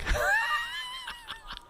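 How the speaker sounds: a high, shrieking burst of laughter. Real, not acted. Laughing at the exploding car story, high shriek. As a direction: cackle up high at the absurdity.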